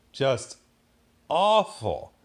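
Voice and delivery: exasperated emphasis; emphatic. Real, not acted. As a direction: land the word awful hard. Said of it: "repeated verdict".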